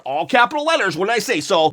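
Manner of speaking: usual speaking voice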